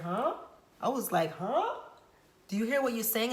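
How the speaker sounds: loud, high incredulous challenge; mock outraged confrontation; indignant huh. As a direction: hit him with disbelief. She confronts father in retold scene.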